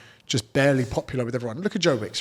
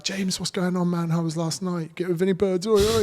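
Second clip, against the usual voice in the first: matey fan greeting; 7 semitones higher than usual. Retold or imitated. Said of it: "They're like".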